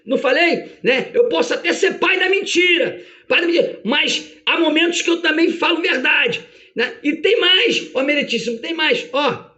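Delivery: high-pitched, gloating accuser; excited, insistent I-told-you-so accusation; Satanás. Acted. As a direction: crow triumphantly to the judge as prosecutor. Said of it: "E aí Satanás diz".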